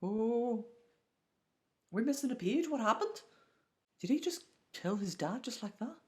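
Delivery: very high-pitched, stunned outburst; real shock. Real, not acted. Reader reacting to a sudden killing in the manga, real shock. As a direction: squeal it out in disbelief.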